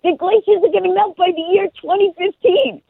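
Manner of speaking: high, urgent correction; insistent mom. Retold or imitated. Mom insisting to son, high and urgent. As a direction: push the point eagerly. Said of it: "I'm like".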